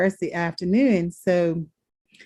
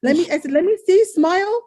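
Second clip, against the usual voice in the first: much higher, eager disbelief; incredulous excitement; 10 semitones higher, 3 dB louder than usual. Retold or imitated. Daughter begging father to smile and show his teeth, high and eager. Daughter demands to see dentures.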